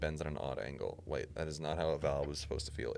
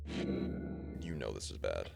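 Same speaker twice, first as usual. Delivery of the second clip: slow ominous; 8 semitones lower, 3 dB quieter, much slower than usual. A narrator's reading. Ominous narration.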